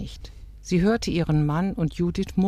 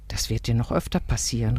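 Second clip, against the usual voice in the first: ordinary delivery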